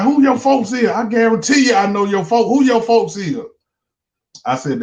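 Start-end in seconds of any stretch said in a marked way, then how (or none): 0.0-2.5 high eager question; imitating the girl. The girl asking about his people, high mock voice. 2.5-3.4 high eager repeat question; imitating the girl again. The girl pressing him about family, high mock voice.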